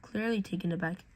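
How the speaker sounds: usual speaking voice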